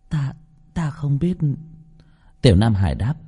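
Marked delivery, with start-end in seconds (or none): 0.1-1.4 put-on high, slow, halting child voice; hesitant little boy. A narrator's reading. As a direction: answer haltingly as a small boy. Boy answers. (giọng bé trai cao, ngập ngừng)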